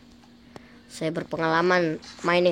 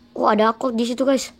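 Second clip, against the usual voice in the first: usual speaking voice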